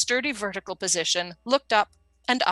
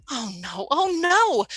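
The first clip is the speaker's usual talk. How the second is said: dismay; 6 semitones higher, slower than usual. Acted. Coffee spilled, panicking.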